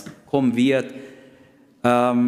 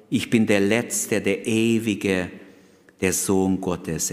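solemn slow quote; 2 semitones lower, slower than usual. Retold or imitated. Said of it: "Jesus speaking".

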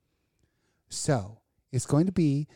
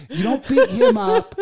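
much higher and louder, slow and hammering on beat; emphatic shout; 8 dB louder than usual. Real, not acted. Ranting to cohost about cheaters, slow emphatic shout. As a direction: yell it down at the listeners, slowly.

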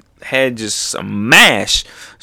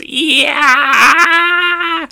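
very high, long shriek; hammed-up cartoon scream; imitated page-wide scream; 4 dB louder than usual. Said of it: "it goes".

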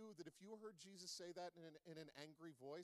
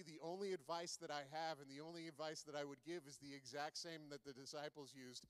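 ordinary talk

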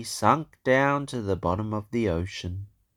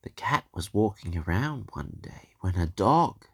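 plain reading voice

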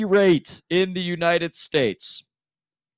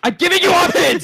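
high, loud and fast outburst; mock angry shout; 7 dB louder, faster than usual. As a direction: yell back at the hecklers. Host snapping at heckling players.

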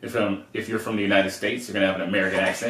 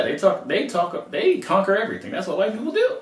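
worked up; 9 semitones higher than usual. Real, not acted. Arguing about accents and conquest.

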